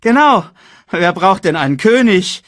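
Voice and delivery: very high, loud parroting hyena; Banzai echoing. A narrator's reading. Hyena echoing his companion, very high and loud. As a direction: squawk it back eagerly. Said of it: "echote Banzai".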